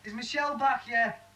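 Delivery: high, projected question; calling out. Acted. Man asks Marie after Michelle, calling out. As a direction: call the question through to another room. Question called to another room.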